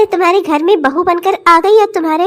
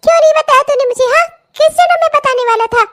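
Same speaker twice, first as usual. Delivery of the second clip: high, fast exasperated telling-off; exasperated rebuke; 7 semitones higher, faster than usual. Acted. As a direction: pelt him with questions, high and fast. Mother rebukes son.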